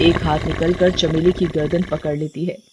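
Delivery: usual speaking voice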